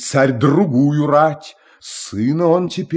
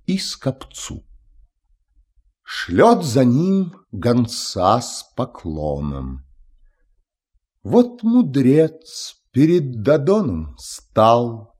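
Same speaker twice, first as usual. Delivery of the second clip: ordinary delivery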